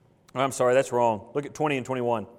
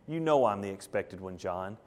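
low, soft, knowing reassurance; quiet assurance; 8 semitones lower, 7 dB quieter than usual. Acted. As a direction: gently remind a doubting friend. Jesus gently reassures doubting John.

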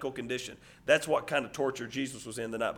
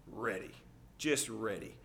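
tense hush; 5 semitones lower, 13 dB quieter, slower than usual. Acted. Parent waiting to jump in.

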